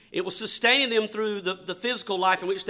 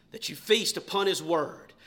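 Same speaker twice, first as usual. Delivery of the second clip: normal talking voice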